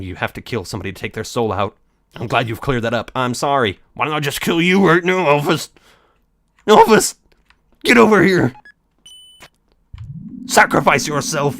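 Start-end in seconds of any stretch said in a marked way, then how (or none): 3.9-11.6 loud, high menacing shout; mock threat shout. Speaker threatening Elvis, loud mock menace. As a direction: bark it like a villain.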